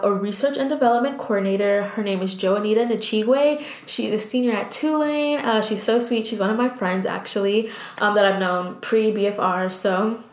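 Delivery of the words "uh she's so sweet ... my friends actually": ordinary talk, nothing marked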